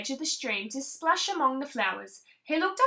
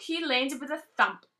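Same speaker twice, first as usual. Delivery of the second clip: usual speaking voice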